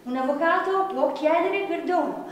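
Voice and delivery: ordinary delivery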